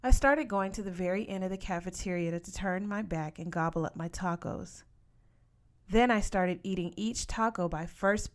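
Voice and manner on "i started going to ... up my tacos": plain reading voice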